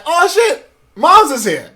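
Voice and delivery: excited retold exclamation. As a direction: burst out at seeing mom. Retelling delight at finding mom.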